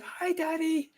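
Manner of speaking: put-on high-pitched child's hello to a parent; little daughter's greeting. Quoting young daughter's greeting.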